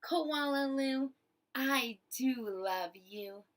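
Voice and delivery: put-on gentle, fond mother speaking to her child; tender mother. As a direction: coo it to your baby. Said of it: "laugh and shake her head and say".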